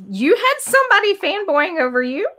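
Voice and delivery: asked with pitch up high in disbelief; surprised question. Real, not acted. Host asking Daryl about a fan, surprised. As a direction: sound genuinely surprised he was fanboyed over. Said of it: "You sound surprised".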